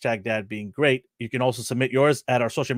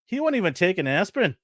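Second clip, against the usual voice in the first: put-on Irish old woman; 6 semitones higher than usual. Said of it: "Let me get my Irish accent out".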